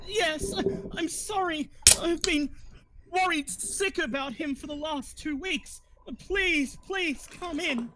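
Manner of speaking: high, overacted worried pleading; fake tearful actress. Actress fakes grief to the party, high and overacted. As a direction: play up fake worry, pitched high.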